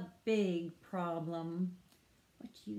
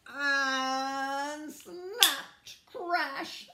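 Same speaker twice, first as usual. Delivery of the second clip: loud, raised, held then broken off; dramatic crash; 7 semitones higher, 6 dB louder, much slower than usual. A narrator's reading. Narrator tells children the branch broke, big dramatic sound. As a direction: stretch the start, then hit the crash.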